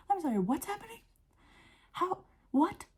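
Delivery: high-pitched, halting disbelief; mock-stunned confusion; baffled. Imagined baffled response to news.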